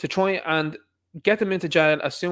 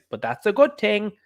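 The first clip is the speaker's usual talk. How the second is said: deadpan mock sincerity, pitched up; mock straight face; 6 semitones higher than usual. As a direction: parody them saying it with a straight face. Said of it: "with a straight face".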